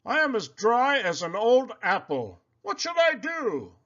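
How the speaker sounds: a pig fretting over his dry skin; worried Poppleton. A narrator's reading. Pig calling his friend, high and dismayed. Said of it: "said Poppleton".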